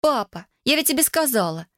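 high-pitched whiny protest to dad; wailing. A narrator's reading. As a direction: wail it lightly, like a teenager. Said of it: "взвыла Эмили". (жалобно, с подвыванием)

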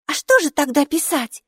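high-pitched puzzled question; surprised. A narrator's reading. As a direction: ask in a high character voice, taken aback. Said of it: "удивилась Майки".

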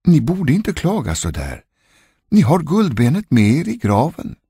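high-pitched, reproving maid; chambermaid. A narrator's reading. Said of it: "sa damens kammarjungfru". (förmanande kammarjungfru med ljus röst)

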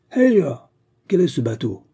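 furious high shout that settles into a loud demand; angry sailor challenging a strange boat. Acted. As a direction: bark at the intruding boat. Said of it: "d'un ton furieux".

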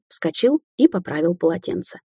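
ordinary delivery

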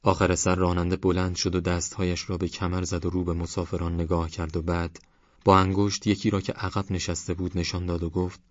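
plain reading voice

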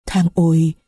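usual speaking voice

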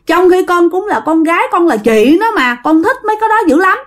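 high, fast wailing demand from a jealous spirit; possessed child wailing. Retold or imitated. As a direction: shriek out the grievance like a spoiled ghost child. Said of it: "Nó gào lên như vậy các bạn".

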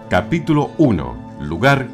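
usual speaking voice

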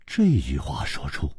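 plain reading voice

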